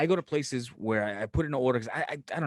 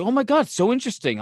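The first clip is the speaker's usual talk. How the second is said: mock gushing listener; 7 semitones higher, 4 dB louder than usual. People reacting to his stories.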